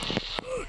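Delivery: high sharp gasp of fright; quick frightened gasp; startled gasp. Real, not acted. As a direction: catch your breath in shock. Startled, monster right there.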